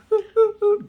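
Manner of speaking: a sudden high, loud laugh; short loud amused laugh; burst of laughter. Real, not acted. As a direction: break into a real laugh mid-sentence. Laughs at his own point.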